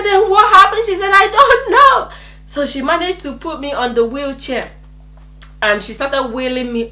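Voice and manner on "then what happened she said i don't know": high, loud frantic back-and-forth; panicked exchange. Retold or imitated. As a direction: act out two people in alarm. Retold panicked exchange with nurse.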